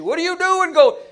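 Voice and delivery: high, loud mock yell after a walkout; retold scream. Preacher yelling at someone leaving the service, loud mock scream. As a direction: shout it like a preacher chasing you out. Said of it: "screaming at you".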